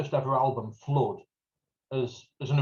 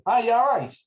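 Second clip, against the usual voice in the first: high, louder call across the pub; friendly greeting; 8 semitones higher, 6 dB louder than usual. Retold or imitated. Greeting a mate across the pub, loud and high. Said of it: "I'm going to him".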